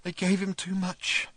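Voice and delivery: high, slow, whimpering; tiny whimper. A narrator's reading. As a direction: whimper it out, small. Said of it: "he said in a tiny whimper".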